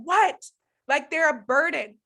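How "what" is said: high, loud snap; yelling. Retold or imitated. Mom yelling at kids who need help, snapping yell. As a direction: mimic an impatient yell at the kids. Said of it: "I'm yelling at them".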